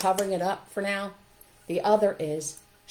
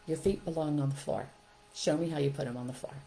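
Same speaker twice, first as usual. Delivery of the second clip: lower, softer instruction; calm direction; 3 semitones lower, 4 dB quieter than usual. Shown as a demonstration. Teacher to child on rocking chair, calmly directing. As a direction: guide the child down without scolding. Models calm alternative to scolding.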